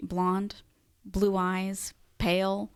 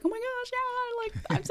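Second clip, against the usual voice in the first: much higher, gushing retold excitement; excited; faster than usual. Retelling her thrill at a quinceañera invite, gushing much higher. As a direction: squeal it like a thrilled teen invited to a party. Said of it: "I was like".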